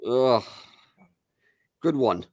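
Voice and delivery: normal talking voice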